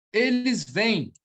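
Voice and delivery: ordinary talk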